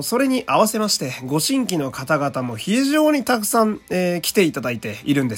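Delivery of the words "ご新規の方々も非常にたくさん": ordinary delivery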